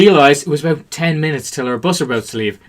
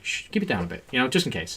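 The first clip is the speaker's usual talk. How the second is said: hushed, much softer, urgent; hushed warning; 4 semitones lower, 15 dB quieter than usual. Retold or imitated. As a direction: hush them before anyone hears. Hushing loud friends in restaurant.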